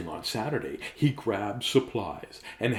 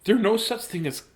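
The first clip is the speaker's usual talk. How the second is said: higher, louder firm mum; 9 semitones higher, 5 dB louder than usual. Acted. Mum dismissing her son's fear, firm and higher. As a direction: scold like a no-nonsense mum. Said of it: "Mum said".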